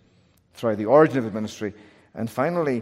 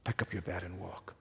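hushed whisper; 5 semitones lower, 19 dB quieter than usual. Retold or imitated. Preacher voicing Jesus whispering to the paralyzed man. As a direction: breathe it gently to the paralyzed man. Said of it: "Jesus looks at the paralyzed man and says".

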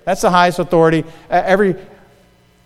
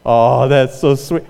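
mock wife voice; 4 semitones lower, slower than usual. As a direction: drawl it out as the charmed wife. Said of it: "she's still going".

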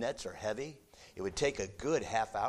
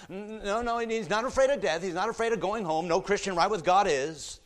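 usual speaking voice

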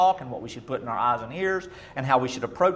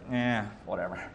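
dismissive shrug; 5 semitones lower, 9 dB quieter than usual. Retold or imitated. God shrugs off Cain's work.

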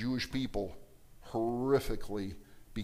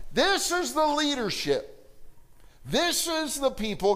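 THIS hit high, leadership said slowly; stress on THIS; 11 semitones higher than usual. Real, not acted. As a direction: point hard at THIS. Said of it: "preacher emphasizes contrast".

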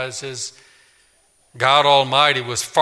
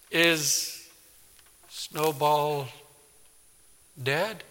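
put-on softer, slow halting child question; hesitant quiet question; 6 dB quieter, much slower than usual. Child fearfully asking about the pet, soft halting. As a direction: ask it dreading the answer.

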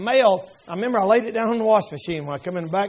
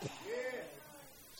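shouted pray up; 13 semitones higher, 27 dB quieter than usual. Acted. Preacher calls congregation to pray, shouting. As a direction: belt out "pray up". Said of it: "repeated phrase".

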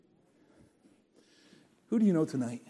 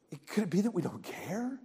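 ordinary delivery

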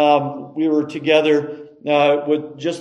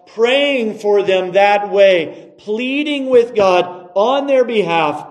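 raised, insistent sermon voice; emphatic preaching; 6 semitones higher, 4 dB louder than usual. Acted. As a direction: drive each point home to the congregation.

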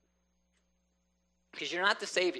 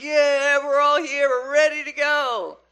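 high, loud, eager soldier voices; eager army; 9 semitones higher, 10 dB louder, slower than usual. Retold or imitated. Army shouts to Gideon, ready to march, in loud eager voices.

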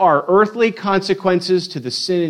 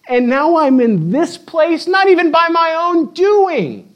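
anguished; 7 semitones higher than usual. Retold or imitated. Jacob's anguished inner questioning.

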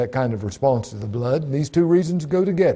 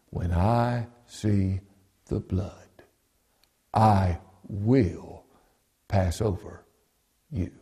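slow, low and measured, pausing between phrases; softer, drawn-out solemn quote; solemn divine pronouncement; 5 semitones lower, 5 dB quieter, slower than usual. Acted. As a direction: weigh each word as God's own promise. Quotes God's words solemnly, slowly.

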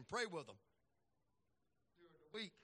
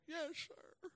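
put-on higher, softer timid little boy; small boy voice; 12 semitones higher, 7 dB quieter than usual. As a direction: answer meekly as a child. Voicing his young son.

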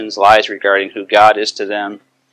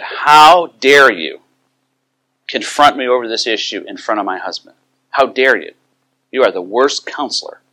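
upset; 3 semitones higher than usual. Retold or imitated. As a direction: act out her offended accusation. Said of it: "she got very upset with me".

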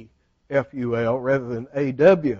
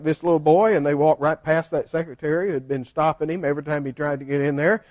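ordinary talk, nothing marked

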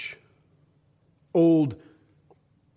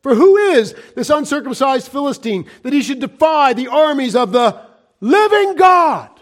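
building outcry; 7 semitones higher than usual. Acted. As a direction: proclaim it like David's challenge, swelling to a cry.

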